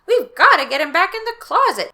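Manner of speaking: high, loud urging; urgent. Acted. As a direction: press her to act. Dodger urging.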